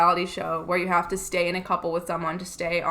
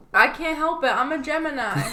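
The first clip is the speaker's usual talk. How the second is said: higher-pitched mock excuse; whiny-girl meme quote, pitched up; mocking Gemini excuse; 6 semitones higher than usual. Podcaster mimicking meme girls blaming their star sign, mocking. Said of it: "bitches will be like".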